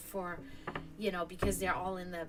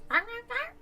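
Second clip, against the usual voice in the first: cat talking; 6 dB louder than usual. Retold or imitated. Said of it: "he goes".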